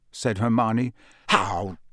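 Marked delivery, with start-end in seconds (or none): 1.3-1.8 mock outrage. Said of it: "said Ron in mock outrage".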